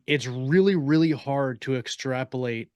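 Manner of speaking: normal talking voice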